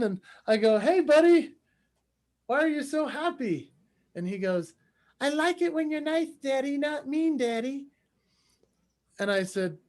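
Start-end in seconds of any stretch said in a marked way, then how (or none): 0.8-3.7 put-on high, playful dad-to-little-kid voice; higher-pitched parent voice; talking to a toddler. Dad talks to his little boy. 5.2-7.9 put-on high little-kid voice; pitched-up toddler impression; three-year-old boy. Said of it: "who at the time was three".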